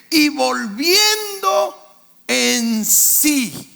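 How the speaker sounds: slow call for the congregation to echo; slow preached phrase. Shown as a demonstration. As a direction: stretch "volviendo" slowly for the church to repeat. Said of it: "Digan conmigo".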